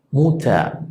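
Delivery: muta: final en a, sin r; r final vocálica. Shown as a demonstration. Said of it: "no suena muter". (muta, r vocalizada)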